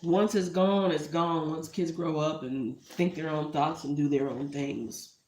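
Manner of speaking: usual speaking voice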